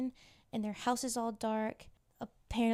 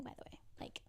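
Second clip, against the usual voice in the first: much softer, whispered side note; whispered aside; 18 dB quieter, much faster than usual. Retold or imitated. Lauren quietly asking Summer to leave Chloe out, whispered. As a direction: slip it in like a secret.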